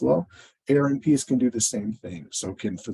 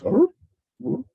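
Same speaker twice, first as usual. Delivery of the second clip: very high, louder cartoon whimper of confusion; Scooby-Doo confusion; 4 dB louder than usual. Therapist retelling a cartoonish baffled reaction. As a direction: squeak it out like a baffled cartoon dog. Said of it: "I did one of the Scooby-Doo things".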